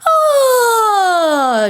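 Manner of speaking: high gliding yawn, falling down; yawn sigh. Shown as a demonstration. Coach demonstrating a morning yawn warm-up. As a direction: let out a big relaxed yawn. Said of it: "where you just go".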